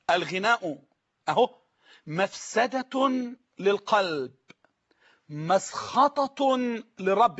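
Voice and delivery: very slow, each word set apart with long pauses, مسخطة lifted; dictating slowly. Shown as a demonstration. Preacher repeating the saying for viewers, word by word. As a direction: spell it out so it sinks in. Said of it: "كلام واصل يا رب يكون واصل".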